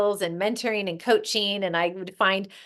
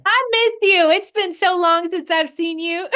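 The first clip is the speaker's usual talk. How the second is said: high, loud happy greeting with a laugh; light up at seeing her; delighted greeting; 9 semitones higher, 6 dB louder than usual. Real, not acted.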